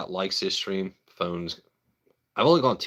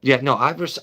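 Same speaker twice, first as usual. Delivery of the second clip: ordinary talk, nothing marked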